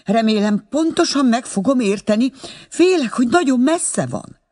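high-pitched voice straining down a long-distance line; anxious old mother on phone. Acted. (idős anya izgatottan telefonál)

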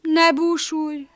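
slow, high, soothing donkey voice; gently drawn-out comfort from the old donkey; consoling. A narrator's reading. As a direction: comfort the dog slowly. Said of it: "vigasztalta a csacsi".

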